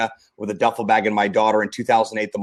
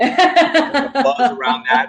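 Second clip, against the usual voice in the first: mock buzzword; 12 semitones higher, 5 dB louder, much slower than usual. Mocking a buzzword.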